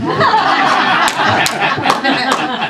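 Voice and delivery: a loud, high burst of real laughter; big laugh. Real, not acted. Laughing at the yogi's gesture.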